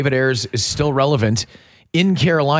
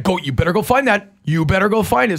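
raised-pitch mock-stern warning; dad voice; 6 semitones higher than usual. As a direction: warn your kid like a no-nonsense dad. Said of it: "This is my dad voice".

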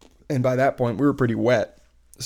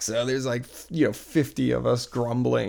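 normal talking voice

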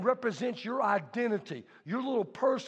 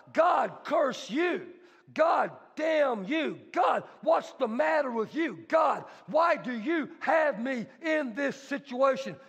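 raised, higher shouting at God; angry cursing; 3 semitones higher, slower than usual. Acted. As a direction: rail at God in fury. Peter voiced cursing God.

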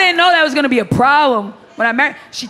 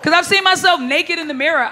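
ordinary delivery

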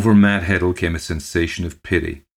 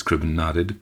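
ordinary delivery, nothing marked

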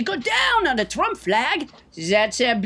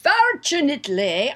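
ordinary talk, nothing marked

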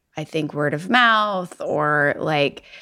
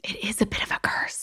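dramatic whisper; 2 semitones higher, 11 dB quieter than usual. Pretend. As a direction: breathe it like a dark secret. Echoes the line in a dramatic whisper.

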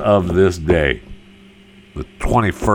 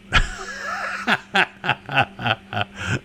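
soft rolling chuckle; real laugh; 3 semitones higher, 7 dB quieter than usual. Real, not acted. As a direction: laugh on and on at your own joke. Laughs at own joke.

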